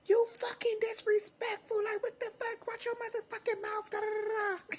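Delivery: very high, strained mock mother; imitating mom's cracked high rant; shrieking mom. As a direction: screech like a furious mom. Mother snapping back at teen.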